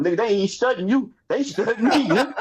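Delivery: high-pitched comic brag; amused, high-voiced bragging; gleeful boasting. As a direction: crow it, climbing in pitch toward a laugh. Comic boast before breaking into laughter.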